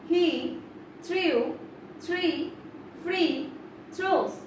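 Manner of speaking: each word said alone with a pause between; "He" "threw" "three" "free" "throws" as separate beats. Shown as a demonstration. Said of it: "level one".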